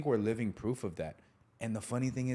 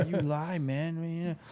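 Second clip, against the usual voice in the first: high mock-angry whine, laugh breaking in; mad at brother; 8 semitones higher, slower than usual. Telling off his brother over Santa, mock mad. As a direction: replay a kid's accusation, half laughing. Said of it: "getting mad at my brother".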